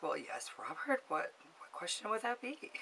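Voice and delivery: soft, mock-composed phone voice; careful, understated show of being smart; trying to sound smart. Caller trying to sound really smart for Robert, softly composed. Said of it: "sound really smart".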